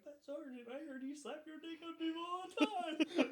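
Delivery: very high mock-whiny voice, laughing; pleading protest. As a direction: protest to the sergeant in a squeaky, tearful whine. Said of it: "I was like".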